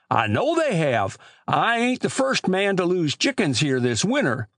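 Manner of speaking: put-on high-pitched rustic old man; old country storekeeper. Old storekeeper grumbling to boys about chicken thieves, high rustic voice.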